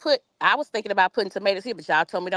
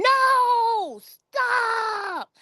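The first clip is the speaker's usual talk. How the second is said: retold hollering. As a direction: imitate a friend screaming at you to stop. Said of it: "Miss Cheryl was hollering".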